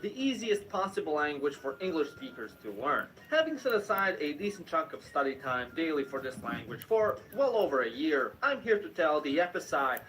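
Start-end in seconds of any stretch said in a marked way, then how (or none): none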